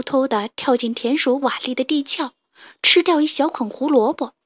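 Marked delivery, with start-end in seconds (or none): none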